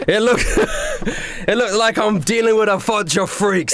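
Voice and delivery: mock the freaks in front of you; scornful chef. Chef mocks the juggler.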